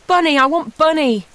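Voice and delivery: put-on high, loud child's wail; a little girl wailing for her toy. A narrator's reading. Little girl wails at her foster mum from the car seat, high and loud. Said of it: "Bobby wailed".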